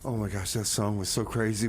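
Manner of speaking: ordinary talk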